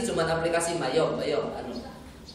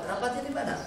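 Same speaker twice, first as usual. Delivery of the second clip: much higher, pushing the secretary hard; pressing tone; 8 semitones higher, 3 dB quieter, faster than usual. Shown as a demonstration. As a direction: demand an answer, leaning on her. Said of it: "mulai ada tekanan-tekanannya". (penguji menekan sekretaris dengan nada tinggi)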